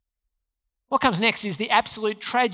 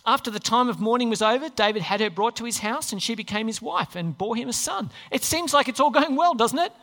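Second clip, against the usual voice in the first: ordinary talk, nothing marked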